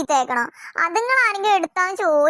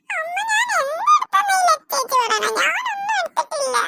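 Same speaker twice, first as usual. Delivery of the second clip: much higher, slow, tearful denial; crying little girl; 12 semitones higher than usual. Acted. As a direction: sob that you took nothing. Little girl cries, accused falsely.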